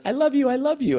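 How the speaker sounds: mock panic, appeasing the attacker; imitating freaked-out child. Freaked-out child turning to his monster dad, imitated plea. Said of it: "he literally freaked out".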